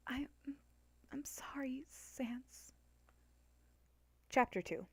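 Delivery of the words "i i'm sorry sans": put-on soft, whispered words from a sleeping girl; sleep mumble. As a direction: murmur it half asleep. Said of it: "Frisk mumbled in her sleep".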